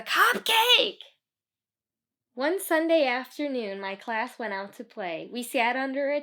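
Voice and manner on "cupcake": high, loud cupcake shout; playful kid shriek; mock shriek. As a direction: squeal the word cupcake. Echoing Michelle's demand.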